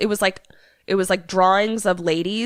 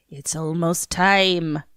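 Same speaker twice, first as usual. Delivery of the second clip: put-on slow, ominous Irish accent; much slower than usual. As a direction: draw it out in a faked Irish brogue. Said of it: "You've been practicing your Irish accent".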